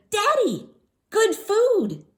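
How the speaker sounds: put-on high, loud childish plea; small girl proudly presenting ice cream; little girl voice. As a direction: sell it like a sweet little kid. Child pleads with father.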